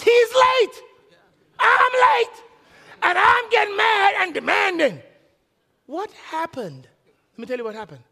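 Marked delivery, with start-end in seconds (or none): 0.0-5.0 high, loud, mocking himself; mock outraged shout. Real, not acted. Pastor scolds his own demanding self, shouting high. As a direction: roar the self-rebuke. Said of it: "demanding little twit".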